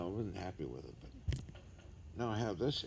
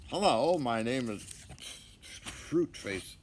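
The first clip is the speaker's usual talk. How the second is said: loud, high goofy intro voice; silly character voice; 5 semitones higher, 5 dB louder, slower than usual. Pretend. Acting silly for the camera, goofy loud intro. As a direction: announce yourself like a cartoon clown. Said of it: "Time to act silly".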